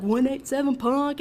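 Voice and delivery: a hint of a mock thug voice; dorky tough guy. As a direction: play the goofy little brother acting hard. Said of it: "Israel's a dork".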